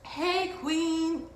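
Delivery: high-pitched imitated holler; mock catcall. Some guy hailing her as she scoots past, imitated catcall. Stranger calls out again.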